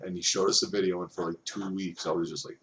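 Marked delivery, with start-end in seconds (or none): none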